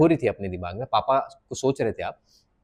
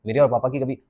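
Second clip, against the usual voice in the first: emotion pressed inside. Shown as a demonstration. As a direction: keep the feeling in, don't let it out. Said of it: "आपने emotions अंदर दबाया है".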